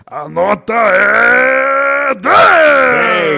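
long drawn-out shout, vowels held high and loud; showman's score announcement. Real, not acted. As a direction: stretch "é" and "dez" like an arena announcer.

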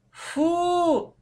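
very high, held-out yuck; child's squeamish squeal; disgusted. A narrator's reading. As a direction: recoil in distaste. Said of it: "скривила губы".

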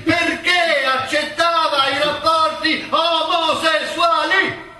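batter the witness until he breaks; furious shouting interrogation. Acted. Prosecutor badgers witness, shouting.

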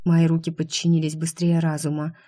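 plain reading voice

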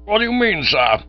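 high, loud outraged demand; indignant cry. Acted. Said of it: "cried the old boy, getting purple".

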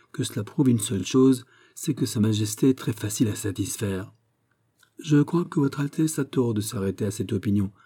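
ordinary delivery

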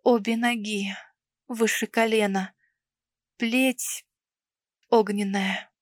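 hushed, halting words near tears; understated trembling distress. A narrator's reading. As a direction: break it into small, quiet pieces with long pauses. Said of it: "Под конец голос Санники дрожал".

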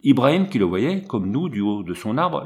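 ordinary delivery, nothing marked

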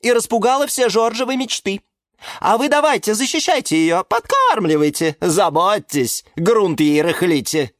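high, snide tirade; high-pitched scornful complaint; spiteful tattling. A narrator's reading. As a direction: pile on accusations maliciously. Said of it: "ехидно поддакнула".

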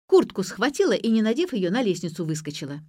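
cross, starting high and loud; angry. A narrator's reading. Daughter explaining how her mother ran off, angrily. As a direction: report it in annoyance, easing off by the end. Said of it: "сердито пояснила моя напарница".

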